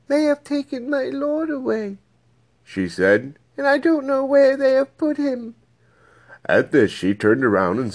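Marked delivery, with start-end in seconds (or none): 0.1-2.0 much higher voice for Mary, distressed; Mary's voice. Acted. Weeping Mary answers the angels. 3.6-5.5 much higher voice for Mary, distressed; Mary's voice. Acted. Weeping Mary answers the angels.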